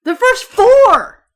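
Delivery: loud, slow, high exclamation; exasperated shout. Real, not acted. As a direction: shout it in disbelief. Exasperated argument.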